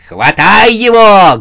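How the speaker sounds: high, loud drawn-out bark; barked shout. A narrator's reading. Сюзанна sets the company on the newcomer, barking loudly. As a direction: bark the order with full force. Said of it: "гаркнула ужасная Сюзанна".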